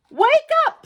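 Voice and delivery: high loud call to wake her. A narrator's reading. Puffin shouting to wake the napping bear.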